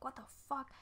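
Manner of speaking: understated, hushed impression of dad's reaction; imitating dad's swearing. Dad sees the call history, quietly imitated swearing. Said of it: "you know how he says it".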